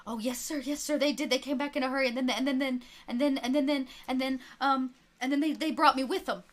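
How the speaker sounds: put-on a nervous kid rushing to please; lightly voiced boy stammering to the preacher; eager boy.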